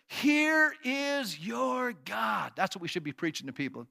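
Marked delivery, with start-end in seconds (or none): 0.1-2.5 drawn-out shout of proclamation; slow ringing announcement; proclaiming. Acted. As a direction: call it across the towns. Proclaiming scripture aloud.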